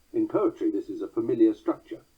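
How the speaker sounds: ordinary talk, nothing marked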